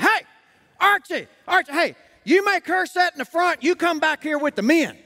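high-pitched mock holler; imitated heckling. Men in the back heckling Archie at the door, imitated holler. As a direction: call out like a back-row heckler. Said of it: "They are heckling me from the back".

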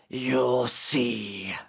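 a demon's parting threat, dropped low; growling monster. Acted. Monster growling at Derek from the doorway, low threat.

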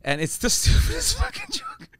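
ordinary delivery

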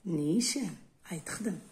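normal talking voice

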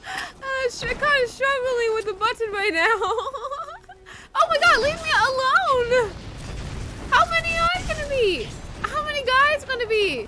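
very high, loud panicked shrieks with laughs; loud, high panicked laughing screams; panicked screaming. Real, not acted. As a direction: scream through it while fumbling the button. Panicked under attack in game.

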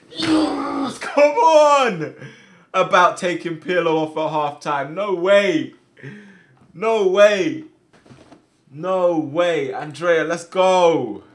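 high, loud roar easing into proud disbelief; elated shouting. Real, not acted. Player celebrates Andrea's goal, elated shouting. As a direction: yell the celebration, then settle slightly but stay loud.

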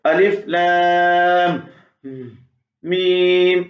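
wrong way, pausing between letters; broken with breaths. Shown as a demonstration. Teacher shows the wrong way, breath between letters. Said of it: "ऐसे नहीं पढ़ना, सांस बीच में नहीं लेना".

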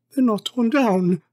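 high, relieved exclamation; excited cry. Acted. Scrooge holding his bed curtains, crying out excitedly. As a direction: cry out the discovery. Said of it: "cried Scrooge".

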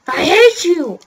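loud, high frustrated cry; angry yell. Real, not acted. Gamer raging at the racer who beat him, angry yell. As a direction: hurl it at the rival.

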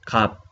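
갑 cut off on closed lips; closed-lip final sound. Shown as a demonstration. Teacher models a new Korean word, closed-lip ending on 갑. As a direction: say 갑, shutting the mouth on the final ㅂ. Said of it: "igual cerrando la boca".

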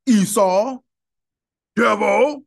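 mock loud name call. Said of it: "you would be like".

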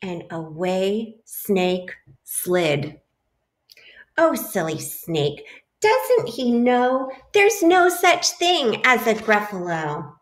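lively picture-book read-aloud; animated storybook voice for a class; reading to children. A narrator's reading. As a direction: read it like story time for little kids.

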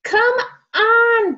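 high loud Come on; eager call. A narrator's reading. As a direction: call your friend over eagerly. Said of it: "Bear waved Mole".

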